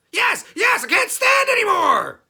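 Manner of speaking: very high, very loud begging; desperate goblin shout. Acted. Trapped goblin begging Herschel to break the spell, very high and loud. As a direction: beg at the top of your lungs.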